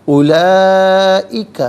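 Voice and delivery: اولئک with the long vowel drawn out slowly and loud; chanted اولئک, held long and high; elongated recitation. Shown as a demonstration. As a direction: stretch the madd in اولئک for five or six counts. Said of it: "پانچ سے چھ حرکات کی مقدار کھینچ کر پڑھ".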